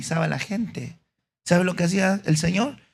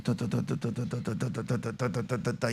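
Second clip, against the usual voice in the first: copying noise; 3 semitones lower, 12 dB quieter than usual. Retold or imitated. Students copying mindlessly.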